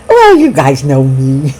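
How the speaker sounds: ordinary talk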